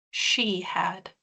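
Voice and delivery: breathy unvoiced whisper, barely there; whispered reveal. Author closing her horror reading on a whispered reveal. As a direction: drop to a whisper to land the twist. Chilling final line of reading.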